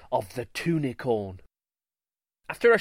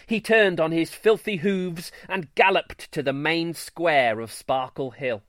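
ordinary delivery

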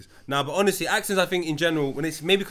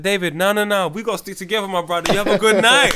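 loud, higher retold plea to a brother; mock pleading; 4 semitones higher, 7 dB louder than usual. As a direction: call it out, acting the loyal mate. Acting out his plea to David.

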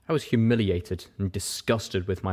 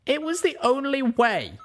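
Pip justifying. Acted.

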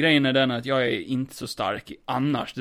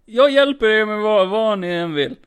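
pleading; 7 semitones higher than usual. Acted. Player begs villains.

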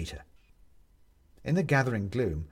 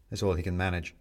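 usual speaking voice

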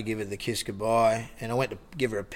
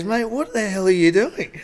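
high, drawn-out mock disbelief; pitched-up, slower incredulous question; 8 semitones higher, 3 dB louder than usual. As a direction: act out someone baffled at catching you. Acting out startled maitre d' catching him.

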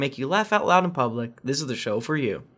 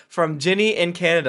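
ordinary talk, nothing marked

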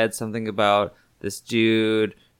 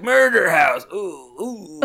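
hammed-up, higher-pitched moan; mock sleep moaning; 8 semitones higher, 4 dB louder, slower than usual. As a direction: moan it suggestively, as a joke. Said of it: "doing both of those at the same time".

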